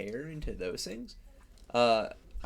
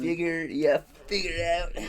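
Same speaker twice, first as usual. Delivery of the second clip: higher, hammed-up Midwestern twang; mock Midwestern accent; 5 semitones higher than usual. Teased over saying figure, hammed-up Midwestern accent. Playfully exaggerating teased pronunciation.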